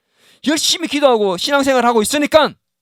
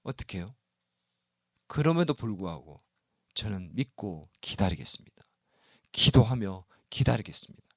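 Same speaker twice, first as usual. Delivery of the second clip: hushed prayer of trust; 12 dB quieter than usual. Acted. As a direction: murmur it like a quiet prayer. Voiced believer's quiet faith.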